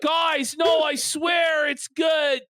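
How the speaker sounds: high, stretched-out insistence; mock pleading. Streamer voicing Joe defending the book to viewers, mock pleading. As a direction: beg to be believed, hammed-up and high.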